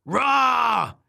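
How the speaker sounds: high, loud "rah"; long high-pitched storybook yell; shouted roar. Acted. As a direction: let out a frustrated roar. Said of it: "she shouted".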